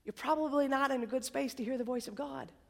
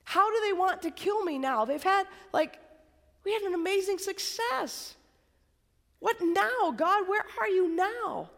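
confused plea; 6 semitones higher than usual. Retold or imitated. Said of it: "He's like".

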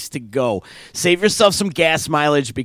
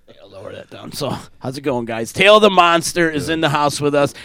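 ordinary talk

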